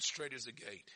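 soft, low "Straight is the gate"; hushed scripture quote. Real, not acted. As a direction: state it quietly and gravely. First, hushed take of the quote.

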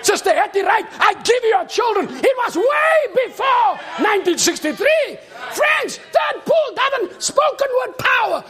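loud, high, shouted sermon climax; full-force preaching. Acted. Preacher shouting praise to the congregation, full force. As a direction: preach it out, rising with each line.

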